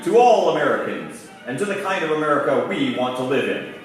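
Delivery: normal talking voice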